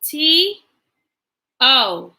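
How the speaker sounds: letter by letter, clear and paced; spelling aloud. Shown as a demonstration. Teacher spelling a sight word for the class, letter by letter. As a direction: spell it out slowly for little ones.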